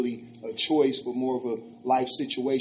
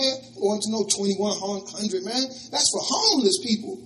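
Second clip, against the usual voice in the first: scornful retort; 7 semitones higher than usual. Retold or imitated. Scornful of homeless.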